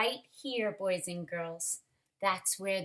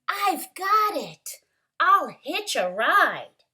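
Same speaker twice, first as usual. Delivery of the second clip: higher, louder sudden idea; excited cry; 8 semitones higher, 9 dB louder than usual. Acted. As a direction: burst out with a bright new idea. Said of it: "then cried".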